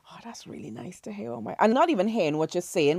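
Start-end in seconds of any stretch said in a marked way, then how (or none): 0.1-1.6 very soft murmur to oneself; hushed inner-voice aside; retold private thought. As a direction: drop right down, as if thinking aloud. Retold private thought while walking.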